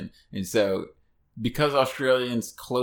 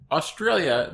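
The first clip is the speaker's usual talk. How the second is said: normal talking voice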